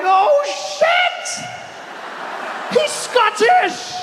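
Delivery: high, drawn-out shocked cry; shocked shout. Retold or imitated. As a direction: yell the discovery in disbelief. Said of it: "shocked realization retold".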